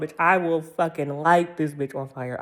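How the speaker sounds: ordinary talk, nothing marked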